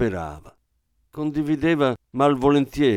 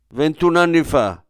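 louder, higher snappish retort; irritated; 6 semitones higher, 6 dB louder than usual. Acted. Wife snaps at husband over the shop's price, irritated and louder. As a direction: bite back at him, stung. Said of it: "rispose lei stizzita".

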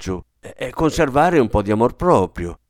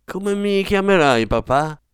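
higher, with a tremble in the voice; a slight tremor, higher; trembling voice; 7 semitones higher than usual. A narrator's reading. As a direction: ask it timidly, voice quivering. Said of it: "con un tremolio nella voce".

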